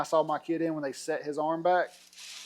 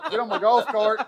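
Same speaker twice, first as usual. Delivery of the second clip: laughing while telling; 7 semitones higher, 5 dB louder, much slower than usual. Real, not acted. Telling a funny story, laughing.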